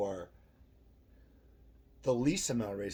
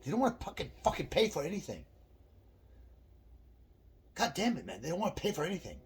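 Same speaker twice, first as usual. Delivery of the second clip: imitating the angry clerk; 8 semitones higher than usual. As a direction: play the fed-up gas station clerk grumbling. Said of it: "he said".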